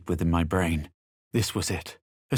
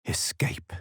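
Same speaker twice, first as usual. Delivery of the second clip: whispered; 4 dB quieter than usual. Acted. Chicken considering escape.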